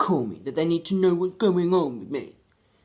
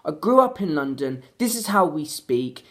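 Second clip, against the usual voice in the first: usual speaking voice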